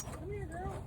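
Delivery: high coaxing voice to a dog; holding down a pet. Real, not acted.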